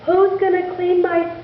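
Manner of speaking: high, worried lament; a mother beside herself with worry; lightly voiced fretting mother; distraught mother. A narrator's reading. Distraught mother laments son's hands.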